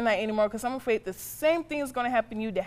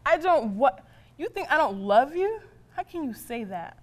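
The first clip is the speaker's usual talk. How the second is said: hurt disbelief; 3 semitones higher, 3 dB louder than usual. Acted. Mother told by son she doesn't love him, incredulous. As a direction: throw it back at him, pitch jumping up. Mother stunned by son's accusation.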